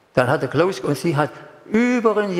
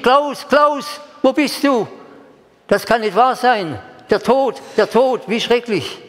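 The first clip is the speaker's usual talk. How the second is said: retold cry of grief; 9 semitones higher, slower than usual. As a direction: cry out for the dead brother. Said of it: "Ich hab geschrien". (Klaus, Klaus: hoch und laut im Schmerz gerufen)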